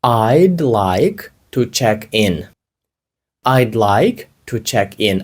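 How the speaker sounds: very slow, word by word, for learners to repeat; slow drill. Shown as a demonstration. Teacher models the phrase very slowly for learners to repeat. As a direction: spell it out slowly for the class. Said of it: "Скажіть".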